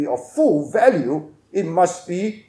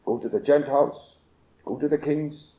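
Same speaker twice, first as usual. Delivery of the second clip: soft, lowered commissioning words; hushed preaching; 7 semitones lower, 9 dB quieter than usual. Acted. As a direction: speak the charge gently and low.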